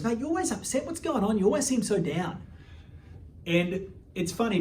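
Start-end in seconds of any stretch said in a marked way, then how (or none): 0.0-2.4 put-on higher, light British accent, a touch concerned; British inmate voice. Pommy inmate checking on a gloomy young prisoner, in a light British voice. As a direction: lightly imitate a caring British mate. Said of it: "Pommy kinda pulled me aside".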